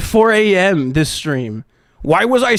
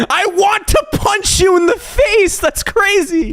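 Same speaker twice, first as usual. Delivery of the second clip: shouting; 10 semitones higher than usual. Real, not acted. Shouting at his old self.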